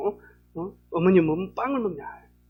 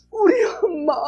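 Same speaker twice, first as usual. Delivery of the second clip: much higher loud sobbing call; crying; 12 semitones higher, 5 dB louder than usual. Retold or imitated. Sister weeps over mother's grave, high sobbing cry. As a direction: cry out for mum through tears. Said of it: "울기 시작을 하는 거예요".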